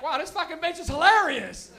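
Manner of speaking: high, loud mock amazement; delighted crowd reaction. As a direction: exclaim it like a thrilled onlooker. Everybody reacting to puppet lady.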